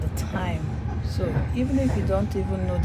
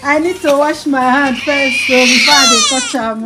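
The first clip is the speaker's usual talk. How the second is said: excited; 3 semitones higher, 10 dB louder than usual. Real, not acted. Excited, loud, near tears.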